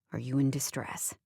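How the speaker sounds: a hint of a dry man; Liam's voice. A narrator's reading. Liam answering phone.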